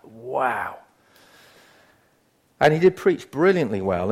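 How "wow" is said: awed wow. Retold or imitated. Preacher recalls hearing a great sermon, hushed awe. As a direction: let out a soft, awestruck wow. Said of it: "It was just like".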